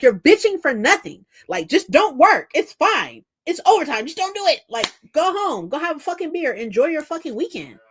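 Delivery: high, punchy retold rant; worked-up, insistent dismissal; exasperated telling-off. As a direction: push each command out, fed up. Retells telling off complaining coworker.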